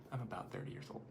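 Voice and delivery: very soft, whispered correction; whispered aside. Real, not acted. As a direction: slip it in under your breath. Murmured aside correcting own age.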